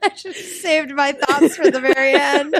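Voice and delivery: high, loud, laughing delivery; real laughing. Real, not acted. Reacting to co-host's rating reasons, cracking up. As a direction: squeal it out through laughter.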